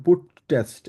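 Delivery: "put test" spelled out slowly with two hard t's; slow, both t's sounded. Shown as a demonstration. Teacher shows the wrong, effortful way to say it. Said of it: "করতে কিন্তু আমাদের মুখ থেকে কিন্তু কষ্ট হয়".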